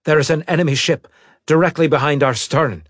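loud, fast alarm shout. Acted. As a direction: yell the warning in a rush. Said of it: "Keith shouted".

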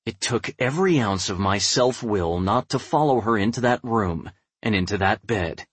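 plain reading voice

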